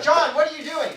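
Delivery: high, loud alarmed call; alarmed bystander. Acted. Bystander yelling at time-travelling John, high and loud. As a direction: shout at John in mock alarm. Ad dialogue voiced.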